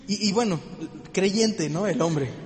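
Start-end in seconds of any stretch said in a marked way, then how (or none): none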